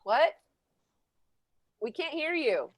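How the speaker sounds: ordinary talk, nothing marked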